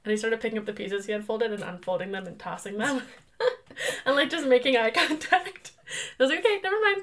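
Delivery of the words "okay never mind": high, sheepish retreat; sheepish backing down. Retold or imitated. Wife giving up teasing husband, high and sheepish. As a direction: back down quickly in a high voice.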